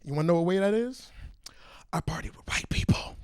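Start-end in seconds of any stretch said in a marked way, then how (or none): 1.9-3.2 secret whispered punchline; conspiratorial whisper. Acted. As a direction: lean in and whisper it. Confiding punchline aside.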